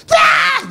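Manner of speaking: sudden high loud shriek; shrieking spirit expelled; spirit's scream. Retold or imitated. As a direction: yelp as the spirit flies out. Spirit leaving the man.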